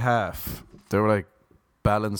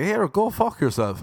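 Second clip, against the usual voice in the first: higher, sharper telling-off; retold snap; 9 semitones higher than usual. Snapping back at the rude seatmate, higher pitched retort. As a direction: fire it back at him. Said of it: "And I was like".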